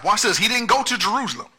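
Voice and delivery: preaching at full force. Acted. Pastor driving home the point to the church, at full force. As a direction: drive the point home line by line. Preaching climax over music.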